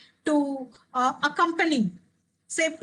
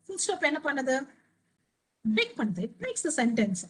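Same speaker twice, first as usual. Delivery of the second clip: usual speaking voice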